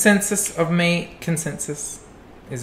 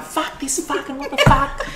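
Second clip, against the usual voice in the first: higher laughing mock outburst; caught swearing; 11 semitones higher than usual. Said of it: "And I'm like".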